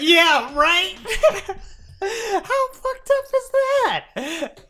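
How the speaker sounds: high and loud, laughing through it; laughing disbelief. Real, not acted. Host reacts to Joffrey showing Sansa her father's head, laughing disbelief. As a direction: crack up in shocked disbelief, slowing right down. Laughing outrage at a plot twist.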